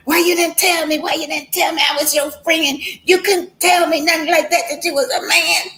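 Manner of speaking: high-pitched scolding at a sick friend; fussing. Retold or imitated. Friend scolding the dying woman, high-pitched fussing. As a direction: scold her for keeping a secret. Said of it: "And she was just fussing".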